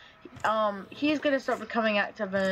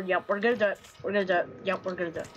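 ordinary talk

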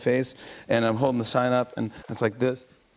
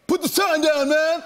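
high yelling heckler from the crowd; laughing mock shout; shouted heckle; 4 dB louder, slower than usual. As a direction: yell it from behind like an annoyed fan. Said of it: "someone behind me goes".

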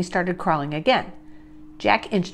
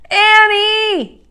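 high, loud, drawn-out name; calling out; 13 semitones higher, 9 dB louder than usual. A narrator's reading. Jack hollering to Annie beyond the hill, loud call. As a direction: holler the name across a distance.

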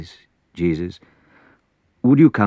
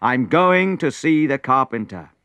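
loud and higher, I'm going anyway; determined shout; 9 semitones higher, 5 dB louder than usual. Acted. Prince shouting down his advisor's excuse, determined. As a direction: declare it with fierce resolve.

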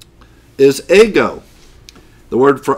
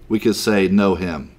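ordinary talk, nothing marked

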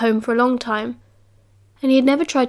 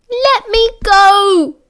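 high, loud, slow desperate cry; frightened child pleading; 9 semitones higher, 7 dB louder, much slower than usual. A narrator's reading. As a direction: beg to be let go. Boy carried off, struggling.

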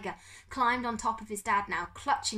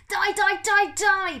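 high, very loud repeated screams; frenzied scream; 13 semitones higher, 12 dB louder, slower than usual. Acted. Pickering gassing the beetles, screaming wildly. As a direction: scream each word full out. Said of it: "Pickering screamed".

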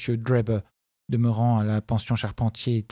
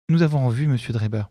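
usual speaking voice

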